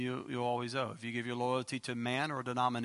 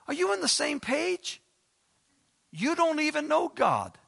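much higher, loud and exasperated; incredulous outburst; 7 dB louder than usual. Retold or imitated. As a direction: fire back at the preachers, higher and louder. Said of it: "and you're like".

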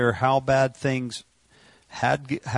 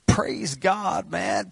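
an inner shout of praise; mock shout of praise; 7 semitones higher, much slower than usual. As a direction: let the heart shout it out. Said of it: "your heart is shouting".

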